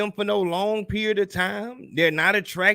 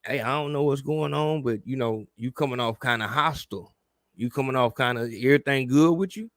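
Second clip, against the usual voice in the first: gentle check-in; 5 semitones lower than usual. Retold or imitated. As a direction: ease into it kindly. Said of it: "tell her about that shit".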